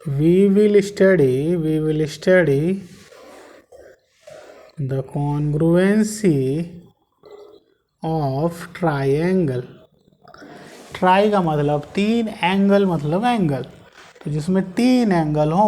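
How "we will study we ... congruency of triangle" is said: very slow, drawn-out dictation; teacher dictating a note at a crawl. Shown as a demonstration. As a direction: dictate each word for students to write. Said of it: "तो यहाँ लिख लें".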